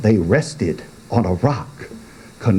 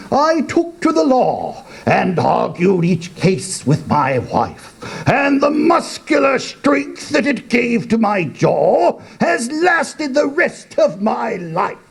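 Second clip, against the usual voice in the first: put-on higher, proud old father; boastful old man; 11 semitones higher than usual. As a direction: brag about his strong jaw.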